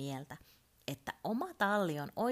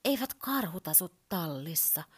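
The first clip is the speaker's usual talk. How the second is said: plain reading voice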